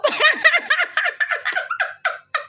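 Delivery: high squealing laugh; shrieking laugh. Real, not acted. Streamer shrieks with laughter at her own joke to a friend, high squeal. Teasing friend about perfume.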